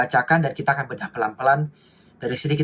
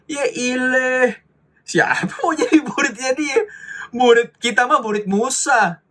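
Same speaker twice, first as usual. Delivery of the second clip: sneering Pharisee; 2 semitones higher, slower than usual. Retold or imitated. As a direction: look down your nose and drawl the put-down. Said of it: "Orang Farisi kayak mau ngomong".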